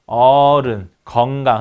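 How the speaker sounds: "어른, 건강" with the first vowels held long; 장모음 어. Shown as a demonstration. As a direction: stretch the opening syllables of "어른" and "건강". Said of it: "할 때 이렇게 씁니다". ("어른, 건강"의 "어"를 길게 발음)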